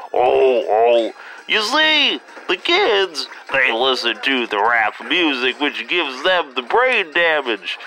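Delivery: put-on high, slow old man rambling; doddering grandpa lecturing; old jazzman voice. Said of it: "now that I got that out of my system".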